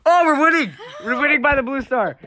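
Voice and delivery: high thrilled burst; excited. Real, not acted. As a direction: cheer it, suddenly hopeful. Sudden lead in the game.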